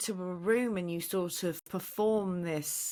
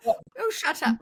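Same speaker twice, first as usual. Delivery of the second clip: high, loud mock-exasperated comeback; mock teen retort; 10 semitones higher, 5 dB louder, slower than usual. Teenager answering mother about the put-on accent, mock exasperation. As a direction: play an embarrassed teen brushing off a parent. Child snapping back at mother.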